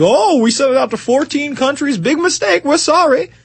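high-pitched, insincere oops; mock company apology. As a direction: play a company making light of its blunder. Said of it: "And then they're like".

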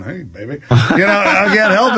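seductive purr. Retold or imitated. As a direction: croon it like flirting back at the TV. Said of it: "on the treadmill at home going".

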